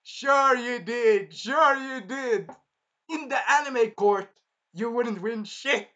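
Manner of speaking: sarcastic jeer. Real, not acted. Mocking the cheating winner.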